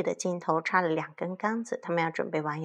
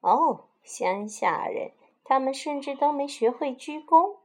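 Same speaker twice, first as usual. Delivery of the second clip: disdainful; 6 semitones higher, 3 dB louder than usual. Acted. Snobbish sheep sneering at newcomers, disdainful. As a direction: look down your nose at the country bumpkins. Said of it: "非常不屑".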